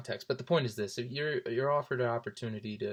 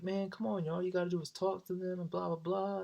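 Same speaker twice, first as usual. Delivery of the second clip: lightly voiced pushy buddy, pitched up; a hint of the smooth friend; friend egging on; 8 semitones higher than usual. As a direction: nudge him like a cocky friend. Friend Nick urging him on.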